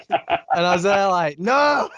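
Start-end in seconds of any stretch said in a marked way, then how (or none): 1.4-1.9 high, loud protesting no; mock shouted no. Refusing Brad's plea to drop the topic, a loud protesting no. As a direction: cry out no like a wounded fan. Arguing with Brad over Game of Thrones.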